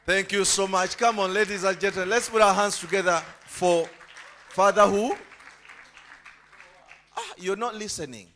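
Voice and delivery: usual speaking voice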